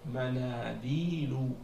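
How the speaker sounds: Manadilu taken slowly, syllable by syllable, then joined with long vowels; don't rush Manadilu: ma-naa, dii, lu; unhurried. Shown as a demonstration. Teacher says don't hurry, Manadilu slowly stretched out. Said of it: "il faut pas se presser".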